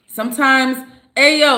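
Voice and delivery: normal talking voice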